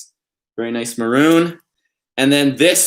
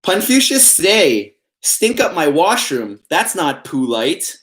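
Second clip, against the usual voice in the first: slow, higher wise-sage delivery; pun reading voice; 4 semitones higher, slower than usual. Pretend. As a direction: read it like a solemn proverb, word by word. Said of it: "I always put on that voice when I'm reading puns".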